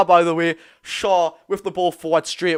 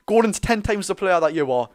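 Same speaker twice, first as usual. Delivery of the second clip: usual speaking voice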